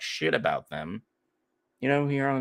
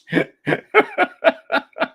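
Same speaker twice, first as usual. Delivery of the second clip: high burst of laughter; 8 dB louder than usual. Real, not acted. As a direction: crack up loudly. Cracking up at own joke.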